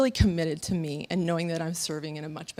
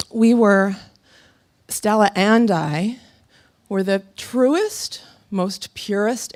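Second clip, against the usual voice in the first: loud and drawn out, savouring each word; slow it right down and let it swell; slow heartfelt awe; 8 dB louder, slower than usual. Real, not acted. Speaker recalling her honeymoon gallop, slow, loud and heartfelt.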